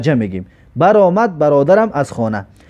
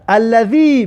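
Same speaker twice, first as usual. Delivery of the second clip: ordinary delivery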